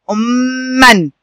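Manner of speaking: أمًا drawn out very slowly, doubled م held, ending -an; teacher stretches أمًا very slowly; slow model word. Shown as a demonstration. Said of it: "هكذا". (نطق أمًا ببطء شديد مع شد الميم)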